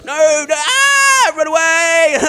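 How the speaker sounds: run-for-your-life shout; panicked shout. Real, not acted. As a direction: scream it while fleeing. Fleeing mobs in the game.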